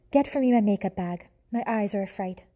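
put-on French accent, imperious toward her son; vain French command. French mother mouse ordering her son to fetch makeup, French accent. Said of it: "She was a French mouse".

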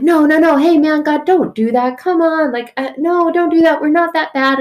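high, urgent imitation of Noah pleading; mock pleading. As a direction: beg God to hold off, hammed-up.

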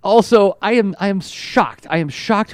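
ordinary delivery

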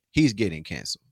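normal talking voice

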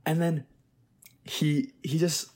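usual speaking voice

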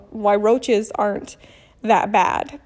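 ordinary talk

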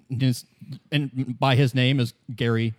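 ordinary talk

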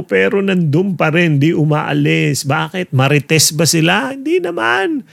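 ordinary talk

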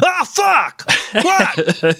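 sudden scared shriek at a coworker; startled yelp. Retold or imitated. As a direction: jump out of your skin with a yelp. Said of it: "he's like".

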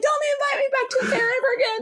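high, fast pretend outburst; mock protest. Imagining the singer fleeing the fair, high mock protest. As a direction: protest as the baffled singer, hammed-up.

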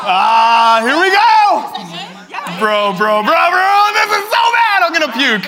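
panicked high yelling; stage fright. Real, not acted. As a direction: shout in real panic before the fall. About to fall off chair.

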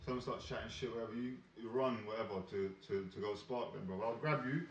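ordinary talk

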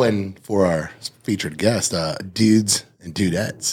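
lucha libre announcer. Acted. Co-host's slow mock intro.

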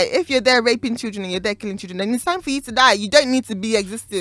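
normal talking voice

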